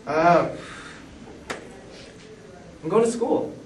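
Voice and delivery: hesitant, lifted-up fake innocence; sheepish excuse. Caught sneaking out at two, sheepishly fibbing to dad. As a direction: play dumb to your dad.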